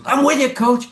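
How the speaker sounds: high-pitched, eager mock reply; imitated eager fan. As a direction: pipe up like a cowed fan pledging loyalty. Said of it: "And the guy goes".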